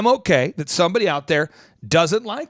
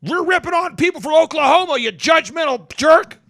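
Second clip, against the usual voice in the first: mock outraged hater yelling at the host; mock outraged critic; 10 semitones higher, 5 dB louder than usual. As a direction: ham up an offended commenter. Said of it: "somebody else is".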